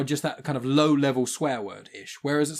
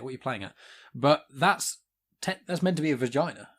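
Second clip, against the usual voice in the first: ordinary talk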